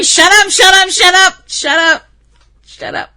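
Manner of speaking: shouting down her own inner voice, then trailing off; mock shout fading. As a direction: yell it down, then let it die away. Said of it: "and I'm going".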